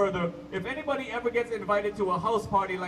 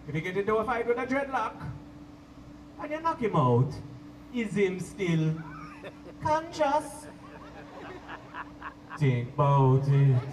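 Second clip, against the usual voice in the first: preaching roots man; 5 semitones lower than usual. Retold or imitated. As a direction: lower Jamaican accent, lay down wisdom. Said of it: "he drops".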